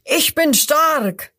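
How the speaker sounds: slow booming cry of strength; proud shout. A narrator's reading. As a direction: crocodile roaring its strength at the hyena. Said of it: "Das erste ruft".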